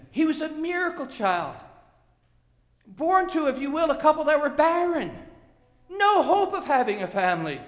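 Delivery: high, ringing preacher's delivery; fervent preaching. Real, not acted. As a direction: proclaim the miracle to the congregation. Preacher builds on the miracle birth.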